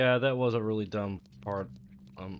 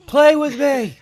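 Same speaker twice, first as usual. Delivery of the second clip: put-on loud, high, demanding child; whiny child shout; 16 dB louder, slower than usual. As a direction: shout it like a bratty kid. Shouting as the niece.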